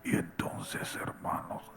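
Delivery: a faint whispered "Y entonces, hermanos"; Bob Sorge's whisper. Retold or imitated. Imitating the whispering preacher Bob Sorge to the church. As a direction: breathe it out with almost no voice. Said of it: "todo el sermón de Bob Sorge es así".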